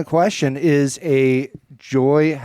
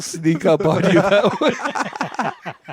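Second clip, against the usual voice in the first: mock ominous; 5 semitones higher, much slower than usual.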